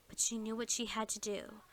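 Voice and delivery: usual speaking voice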